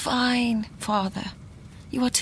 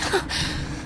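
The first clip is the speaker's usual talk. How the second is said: quick startled intake of breath; sharp gasp; 4 dB quieter than usual. Acted. Laura gasps on hearing of a ghost. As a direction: gasp in shock at the ghost story.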